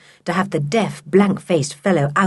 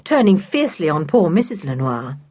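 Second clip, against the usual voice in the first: ordinary delivery, nothing marked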